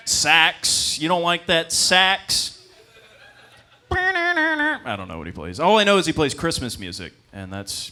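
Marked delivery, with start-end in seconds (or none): 0.1-2.5 mock offended. 3.9-5.6 flustered high reply. Acted.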